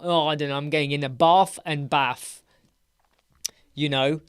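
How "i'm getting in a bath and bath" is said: normal talking voice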